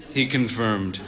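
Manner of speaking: ordinary delivery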